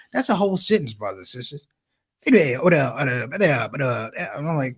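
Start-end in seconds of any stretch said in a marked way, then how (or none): 2.2-4.1 halting, lower sputtering like a cold engine; stalling old father. Retold or imitated. Father trying to tell son mom's upset, halting and low. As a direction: sputter like a car that won't start. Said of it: "He sounded like this".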